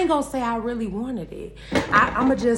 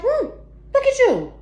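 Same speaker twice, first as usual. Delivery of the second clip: sassy mock voice; 10 semitones higher, 3 dB louder, faster than usual. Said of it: "And I was able to just look like".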